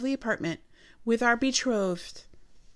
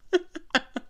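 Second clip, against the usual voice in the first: short, high laugh; rueful laugh; 10 semitones higher than usual. Real, not acted. Woman laughing over being dumped in costume, rueful laugh. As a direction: laugh at your own bad luck. Laughs at her own misfortune.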